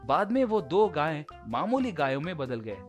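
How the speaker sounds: usual speaking voice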